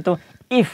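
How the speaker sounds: if clipped short, quick short i; teacher showing a short if; very short sound. Shown as a demonstration. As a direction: keep if very short. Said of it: "बिल्कुल short sound".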